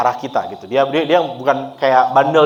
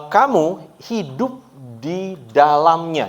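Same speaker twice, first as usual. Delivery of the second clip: slow, higher solemn reading; scripture reading; 4 semitones higher, much slower than usual. Acted. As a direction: read the verse slowly and high. (membaca ayat dengan lambat dan khidmat)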